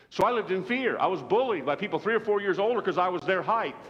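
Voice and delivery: loud, high, driving sermon voice; preaching at full force. Acted. Preacher telling the church about childhood bullies, full force. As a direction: preach it hard and build the story.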